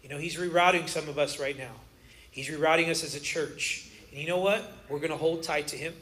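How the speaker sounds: low, soft, unhurried reassurance; hushed, earnest pastoral comfort; sincere, calm. Real, not acted. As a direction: settle down and speak gently of peace. Sincere turn to peace after comedy.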